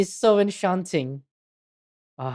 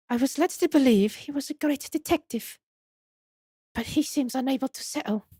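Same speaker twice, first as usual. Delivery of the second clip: high put-on accent slipping British; Esmeralda Tuspels; 8 semitones higher than usual. Madame complains about the detective, French accent slipping British. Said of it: "British again".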